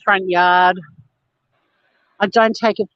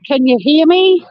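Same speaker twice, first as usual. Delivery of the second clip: higher mock panelist check; lightly mocked, raised-pitch mic check; mimicking panelists; 6 semitones higher, 4 dB louder than usual. Imitating YouTube panelists' first words, mocking. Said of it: "What's the first thing they say?".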